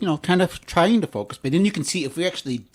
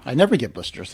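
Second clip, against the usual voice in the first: ordinary delivery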